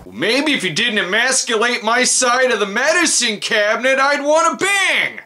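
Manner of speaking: rising, high indignant retort; mock indignant rant. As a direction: snap back at the TV. Ranting back at TV nurse.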